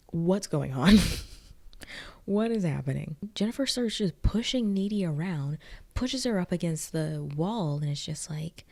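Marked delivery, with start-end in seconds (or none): 0.1-3.2 loud, baffled outburst, lightly acted; mock shock. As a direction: play stunned disbelief. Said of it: "damn near in shock".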